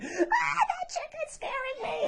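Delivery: small squealed mock scream; screaming kids. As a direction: imitate a scared child squealing. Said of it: "a lot of kids screaming like".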